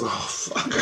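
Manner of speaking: voiceless "oh" under the breath; whispered dismay. Retold or imitated. Quoted exasperated reaction, whispered.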